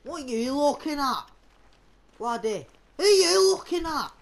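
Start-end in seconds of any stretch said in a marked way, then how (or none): 3.0-4.1 high, loud challenge; confrontational shout. Pretend. Player challenging a stranger in the game, shouting. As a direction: raise the voice sharply to confront.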